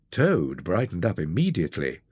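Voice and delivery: ordinary delivery, nothing marked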